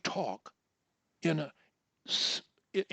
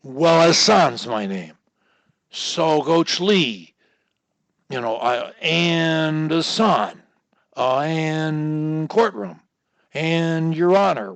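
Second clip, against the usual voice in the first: slow, drawn out; 7 dB louder, slower than usual. Shown as a demonstration. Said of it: "crutch four says".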